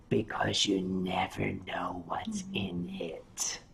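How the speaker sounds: low whisper; hushed warning. Real, not acted. Performer warns fans about fursuits, hushed whisper. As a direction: drop to a whisper, as if confiding.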